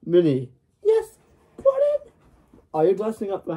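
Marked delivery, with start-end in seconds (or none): 0.8-2.1 squeaky high character voice; high cartoon voice. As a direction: pitch way up, cartoonish.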